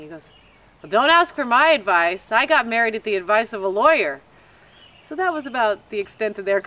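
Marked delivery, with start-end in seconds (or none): 0.8-4.2 loud, emphatic imitated dad quip; mock-dismissive father. Daughter imitates father refusing to give advice, dismissive. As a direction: wave off the question loudly.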